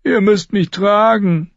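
put-on a scared boy reporting; Lommi. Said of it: "erwiderte Lommi".

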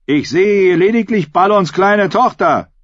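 high, projected jeer; heckler's shout. A narrator's reading. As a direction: call out a belittling challenge. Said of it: "rief Ralf der Hinker".

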